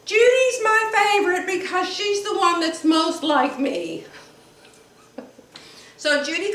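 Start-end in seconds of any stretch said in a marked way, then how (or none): 0.1-4.0 mother's voice. Retold or imitated. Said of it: "my mother always said".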